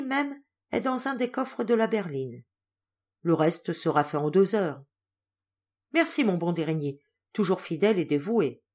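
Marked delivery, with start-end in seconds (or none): none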